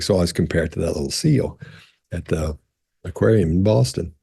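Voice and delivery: normal talking voice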